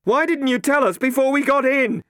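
Matt shouts high and loud over a gale; shouting over wind. Acted. Matt to Catherine in the storm, a loud shout. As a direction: yell it over the howling wind. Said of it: "He had to shout to make himself heard".

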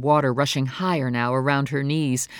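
usual speaking voice

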